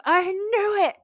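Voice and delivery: high, loud fangirl squeal; super-hyped scream. Acted. Becky learns the books are real, screaming. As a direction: shriek it in vindicated excitement. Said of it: "she, like, screams it".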